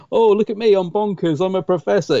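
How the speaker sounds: hammed-up bonkers boffin, lightly sent up; over-the-top nutty professor. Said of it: "it's not doing the whole".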